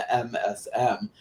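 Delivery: normal talking voice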